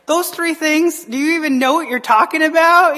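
high, loud incredulous question; re-enacted astonished protest; retold shock. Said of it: "I just looked at her in shock".

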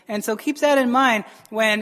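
ordinary talk

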